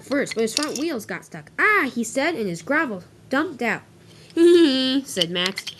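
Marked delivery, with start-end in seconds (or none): 1.6-1.9 high, loud "ah"; short startled cry, pitched up; cry of alarm. Acted. Monty's wheels get stuck. 4.3-5.0 high, loud "hee hee"; acted snicker, loud and pitched up; mocking giggle. Max laughs at stuck Monty.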